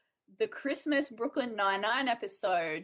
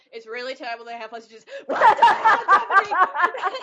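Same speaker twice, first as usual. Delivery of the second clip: mock serious then thrilled; 4 semitones higher, 13 dB louder than usual. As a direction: start solemn, then burst with glee. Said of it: "He's like".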